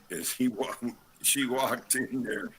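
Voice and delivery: ordinary delivery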